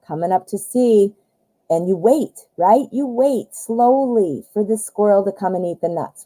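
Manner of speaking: ordinary talk, nothing marked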